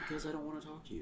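soft teasing whisper. Real, not acted. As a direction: whisper it, refusing to speak up. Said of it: "Why are you whispering?".

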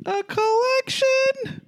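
very high, drawn-out squeal of a question; mock operatic high pitch; silly high voice. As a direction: stretch it out in falsetto. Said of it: "you speak everything in that voice".

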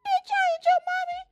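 high, fast mock snap; sassy inner retort. Mother silently answering the girl, high fast sassy retort. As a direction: fire it off like a sassy comeback.

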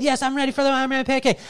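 put-on high, fast little-boy voice, all eager; kid's excited voice. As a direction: play the thrilled little son. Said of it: "He said".

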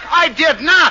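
loud, high outraged protest; indignant denial. Acted. Husband denies pushing the children, indignant shout. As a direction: shout it back at her.

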